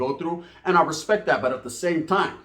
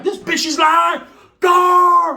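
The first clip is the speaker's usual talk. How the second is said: high, loud, slow outraged shout; mock yell; 12 semitones higher, 8 dB louder, much slower than usual. Warning the guy on the phone she's lying, loud high shout. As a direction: blast it like you're warning a buddy.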